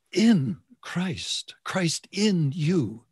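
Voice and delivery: "in" pitched high, set apart by pauses; slow down and punch each "in"; stress on "in". Shown as a demonstration. Preacher correcting religious striving with the key phrase, stress on "in". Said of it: "I want to emphasize the second word".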